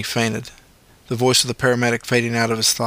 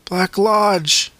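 higher, a bit louder weak voice; a little louder; 9 semitones higher than usual. A narrator's reading. Injured Luke repeating to the paramedic, a little louder. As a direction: push the words out a bit more. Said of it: "a little louder this time".

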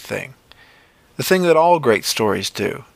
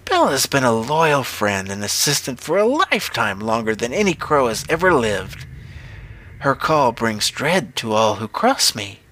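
witch. A narrator's reading. Witch praising her loyal crow Bella, swooping witch voice. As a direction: boast of the bird in an eerie witch voice.

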